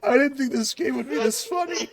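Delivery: high voice squeezed through real laughter; helpless laughter. Real, not acted. Host cracking up at the cheese joke, helpless laughter. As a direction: barely get it out for laughing.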